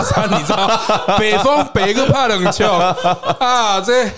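words broken by laughter; laughing. Real, not acted. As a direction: laugh through it helplessly. Laughing while recounting awkward case.

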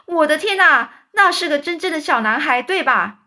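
loud high amazement; loud stunned cry; astonished shout. A narrator's reading. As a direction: yell in disbelief. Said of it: "满脸通红的大叫说".

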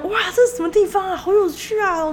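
high, fast hyped-up gushing; excited nephew. Retold or imitated. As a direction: play the overexcited relative. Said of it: "他坐下他就很嗨".

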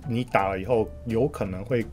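ordinary delivery

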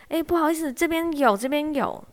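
抬高音调叫住对方, 这边有; 朝走远的人喊一声, 语气客气; 隔空喊人. Retold or imitated. 在后面喊住长官. (在后面喊住走远的长官，提高音调客气地叫)

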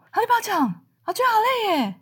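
高八度的激动姐妹腔; 像姐妹冲过来那样又高又急; 激动的姐妹. Retold or imitated. Sister rushes up, grabs hands. (姐妹冲过来握住我双手，高声激动地说)

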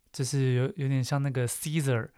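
usual speaking voice

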